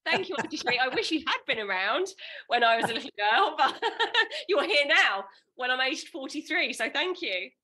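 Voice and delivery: high, loud and laughing thanks; overjoyed, thankful outpouring; elated gratitude. Real, not acted. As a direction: gush with real relief, breaking into laughter. Elated client thanks coach, laughing.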